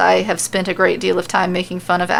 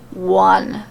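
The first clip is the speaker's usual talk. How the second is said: much lower, booming count; deep voice; 12 semitones lower than usual. Acted. The referee starts the count in a deep voice. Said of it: "he says in a deep voice".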